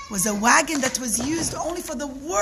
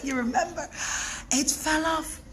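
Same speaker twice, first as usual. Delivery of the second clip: plain reading voice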